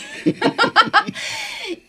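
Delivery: high, bright laugh after her own joke; real burst of laughter. Real, not acted. Host laughing at her joke about the priest's vaccines, real laughter.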